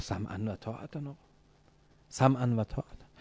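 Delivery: softly submissive assent; quiet obedience. Acted. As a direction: answer meekly, voice dropped well down. Acted obedient assent to the request. (اطاعت آرام و فروتنانه)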